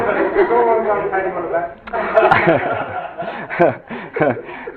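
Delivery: a long, real, delighted laugh; real laughter, high and bright. Real, not acted. Laughs at own clever chart.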